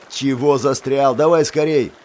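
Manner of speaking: hoarse, loud, slow and cross; hoarse angry shout. Acted. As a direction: bark it out hoarsely. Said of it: "Голос хриплый и сердитый".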